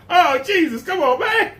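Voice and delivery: high, laughing protest; laughing in disbelief. Real, not acted. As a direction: crack up while pleading. Laughing at the imagined moon.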